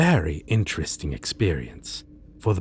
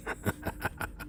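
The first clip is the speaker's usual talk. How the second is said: quiet breathy villain laugh; soft sinister chuckle; 12 dB quieter than usual. Acted. Villain amused by his own threat, quiet chuckle. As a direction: chuckle darkly under your breath. After "Maybe.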